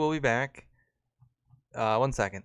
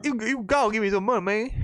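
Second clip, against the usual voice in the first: higher, pleading panhandler voice; begging homeless man; 9 semitones higher than usual. Retold or imitated. Homeless man asking umbrella carriers for money, high pleading. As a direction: beg for money up in a raised put-on voice.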